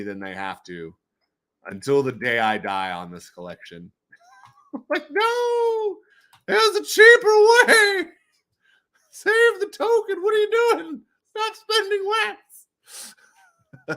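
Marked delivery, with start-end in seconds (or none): 4.9-13.9 high mock scolding; squeaky voice chiding buyers, slowed on the plea. Scolding viewers who waste wax, in a high mock voice.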